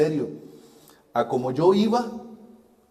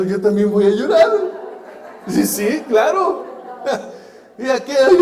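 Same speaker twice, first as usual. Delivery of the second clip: laughing through the words; real laughter breaking into the line; 8 semitones higher, 7 dB louder than usual. Real, not acted. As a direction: chuckle as you say it, amused at yourself. Retelling joining the crying retreat.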